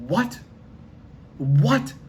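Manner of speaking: high, rising shocked what; stunned reply to a man who drinks no water. Retold or imitated.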